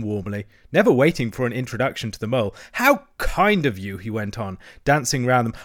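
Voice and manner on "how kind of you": high, slow, gushing thanks; effusive delight. A narrator's reading. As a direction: draw it out with excited gratitude. Toad gushes at his visitors.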